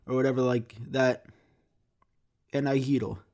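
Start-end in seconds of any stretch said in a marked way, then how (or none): 2.6-3.2 Greek words, gyro with tapped r; speaking Greek. Shown as a demonstration. Showing the Greek order, speaking Greek. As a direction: say it in Greek, YEE-ro. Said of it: "if I'm talking to a Greek person".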